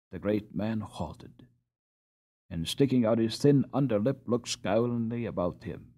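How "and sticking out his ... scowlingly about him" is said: ordinary delivery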